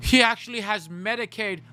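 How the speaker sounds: playfully posh put-down; lightly voiced rich snob; mock rich gossip. Mocking gossip as rich person.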